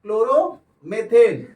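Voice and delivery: slow emphatic answer. Shown as a demonstration. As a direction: announce chloromethane slowly with force. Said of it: "तो क्या हो जाएगा ये?". (Chloromethane धीरे और ज़ोर देकर)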